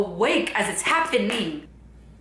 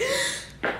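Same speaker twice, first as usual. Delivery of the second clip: startled gasp; 5 dB quieter than usual. Retold or imitated. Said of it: "and I was like".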